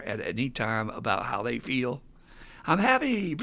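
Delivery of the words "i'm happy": put-on childlike happy. Acting a child expressing feelings.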